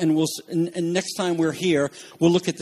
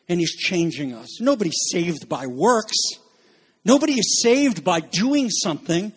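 usual speaking voice

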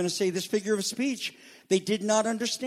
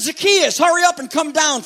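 calling out; 7 semitones higher, 6 dB louder than usual. Acted. Jesus calls Zacchaeus down.

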